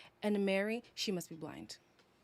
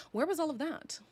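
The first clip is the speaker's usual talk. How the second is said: mock high repeat; 8 semitones higher, faster than usual. Repeating own line mockingly.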